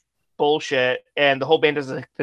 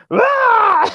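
imitated shrill scream, much higher and louder; mock scream; 8 dB louder than usual. Retelling the singer's scream on the mic, loud mock. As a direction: bark a silly metal scream. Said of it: "he goes".

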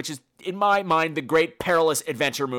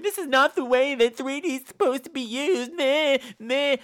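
high-pitched mock temper tantrum; petulant whine, mocking a director's complaint; James Cameron tantrum; 8 semitones higher than usual. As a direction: throw a little tantrum in a high voice. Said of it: "little temper tantrum that James Cameron threw".

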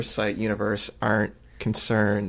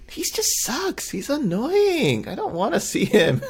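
exasperated whine; 12 semitones higher than usual. Real, not acted. Venting about annoying contestant.

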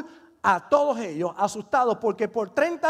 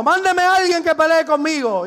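high, loud retold challenge; giant shouting; 5 semitones higher, 6 dB louder than usual. Giant dares the frightened army, shouting. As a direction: bellow out a mock giant's dare. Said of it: "hay un gigante allí gritando".

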